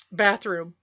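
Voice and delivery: ordinary delivery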